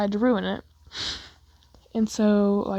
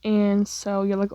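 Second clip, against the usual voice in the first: usual speaking voice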